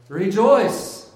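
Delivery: high, loud proclamation; exclaimed angelic greeting. Acted. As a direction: call out like a herald arriving. Angel's greeting acted out again.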